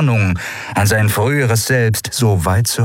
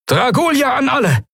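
high, projected commander's call. A narrator's reading. Commander hailing all his pilots, calling out. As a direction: call it out to every ship. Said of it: "rief Quailor".

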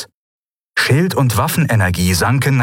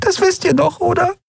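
fragile voice. Acted. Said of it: "sagte mit zerbrechlicher Stimme".

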